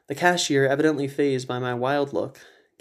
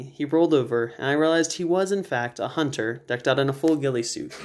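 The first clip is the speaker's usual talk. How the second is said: usual speaking voice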